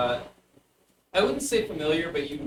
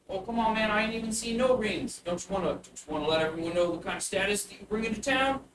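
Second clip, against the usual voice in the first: put-on higher, pushy street-kid pitch; bugbear child voice; 7 semitones higher, faster than usual. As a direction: wheedle like a tiny hustler. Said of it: "a small little bugbear child".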